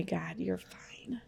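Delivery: soft reassuring whisper. Real, not acted. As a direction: whisper it gently. Reassures friend on scale.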